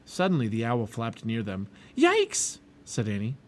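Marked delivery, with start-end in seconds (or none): 1.9-2.6 very high startled cry; startled yelp. A narrator's reading. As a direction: yelp as if something swooped at you. Owl flaps near, Annie startled.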